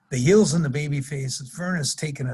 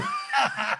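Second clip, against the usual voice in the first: real laugh, higher; high burst of laughter; 8 semitones higher, 3 dB quieter than usual. Real, not acted. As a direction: laugh out the punchline. Laughs after the joke.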